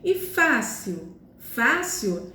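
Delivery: "fácil" with a long, leaned-on "fá"; stress on penultimate syllable. Shown as a demonstration. Teacher contrasting fácil with gentil, stress on the first syllable of "fácil". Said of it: "a penúltima sílaba que é a mais forte".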